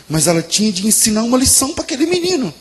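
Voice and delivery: very high, loud cry building to a shout; impassioned preaching. A narrator's reading. Preacher tells of the mother facing her kneeling son, impassioned shouting.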